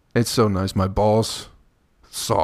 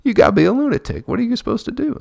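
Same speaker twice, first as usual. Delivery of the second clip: mock outrage; 14 semitones higher, 4 dB louder, faster than usual. Angry retort at the lady.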